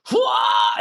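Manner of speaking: shrill mock monster call; throat-whistle shriek. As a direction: imitate a cannibal's eerie throat whistle. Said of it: "this sound that's horrifying".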